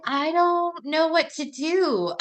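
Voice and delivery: high and slow, overwhelmed; stunned husband. Retold or imitated. Husband hearing wife is pregnant, high slow overwhelmed. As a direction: say it like the news just floored you.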